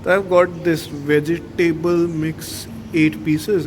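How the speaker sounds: Hinglish, fast casual chatter; hindi english mix. Real, not acted. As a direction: slide between Hindi and English mid-sentence. Casual Hindi English vlog chat.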